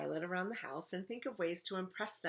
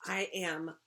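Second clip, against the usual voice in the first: ordinary delivery, nothing marked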